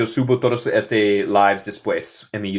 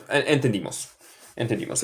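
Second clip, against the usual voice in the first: ordinary talk, nothing marked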